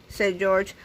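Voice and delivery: usual speaking voice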